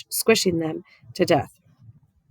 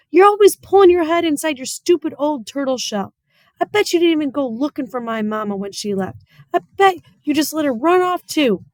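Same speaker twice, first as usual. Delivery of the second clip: high, louder accusing rant; angry outburst; 8 semitones higher, 4 dB louder than usual. Acted. Opal accusing the preacher about her mama, high and angry. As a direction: hurl the blame at him.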